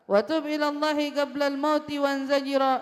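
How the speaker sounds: slow, lilting chant of Arabic verse; poem recitation. Acted.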